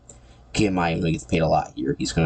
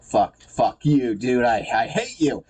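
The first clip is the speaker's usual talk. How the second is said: higher, slow angry mock outburst; hammed-up anger; 5 semitones higher, slower than usual. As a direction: spit it out slowly, building toward a scream. Acts out Peter's angry outburst.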